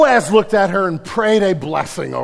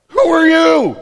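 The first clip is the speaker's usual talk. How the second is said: high loud startled yelp; freaked-out yelp; 11 semitones higher, 10 dB louder, slower than usual. Retold or imitated. Boaz freaking out at a stranger, high startled yelp. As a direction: squeal it like you're terrified. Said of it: "he just freaks out for a minute".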